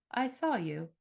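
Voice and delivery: a hint of a cold, menacing demon. A narrator's reading. Demon tells the bartender it watched her, lightly menacing.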